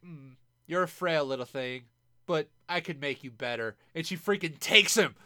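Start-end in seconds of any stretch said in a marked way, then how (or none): 0.1-3.8 lower, coy predatory purr; lightly voiced girl sizing up a weak boy.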